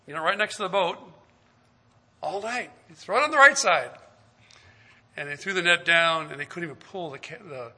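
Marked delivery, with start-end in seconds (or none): 2.9-3.9 raised, higher and quick; urging call. Acted. Jesus urging the fishermen from shore, quick raised voice. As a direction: push them to try it.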